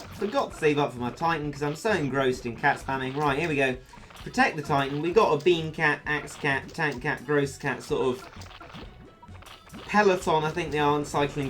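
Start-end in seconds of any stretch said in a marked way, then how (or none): none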